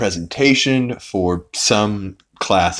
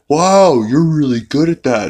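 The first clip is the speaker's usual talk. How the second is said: imitating the phony guy; 7 semitones higher, 4 dB louder than usual. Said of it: "And the guy's like".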